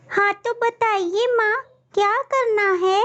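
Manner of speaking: put-on high, sweet child voice; a small girl answering her mother; little girl voice. Child bird voice.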